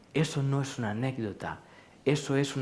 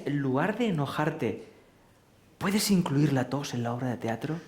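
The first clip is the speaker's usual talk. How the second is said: normal talking voice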